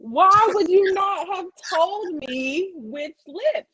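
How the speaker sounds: exasperated whine. Real, not acted. Exasperated complaint.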